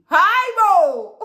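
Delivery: high, very loud shriek of disbelief; hammed-up shocked yell; shocked exclamation. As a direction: cry out in comic outrage. Shocked reaction to invited guest.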